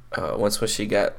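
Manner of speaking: ordinary delivery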